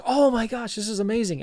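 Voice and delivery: high-pitched mock awe; imitated amazement. Workshop crowd hears the student's results, imitated amazement. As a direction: gush like an impressed onlooker.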